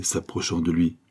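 ordinary delivery

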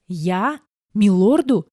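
put-on boy stunned by the order, gasping; shocked boy. A narrator's reading. Boy told to serve the lord, stunned. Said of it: "округлил глаза от шока".

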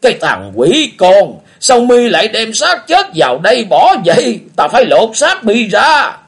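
put-on high, loud, slow gruff old man's scolding; an old beggar berating the boy; old beggar yelling at the boy. Old beggar Lão Cái scolding.

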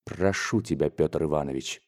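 soft, low, controlled plea; restrained request. A narrator's reading. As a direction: hold anger down and ask quietly. Father restrains anger, asks him out.

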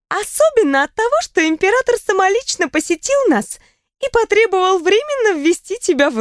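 playful, laughing friend telling gossip; lively young woman. A narrator's reading. Riona continues.

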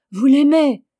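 high, loud cry of disbelief; shouted question. Acted. The old woman cries out at Augustin, loud and high. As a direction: cry it out in alarm. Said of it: "Cria la chavoche".

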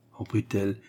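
plain reading voice

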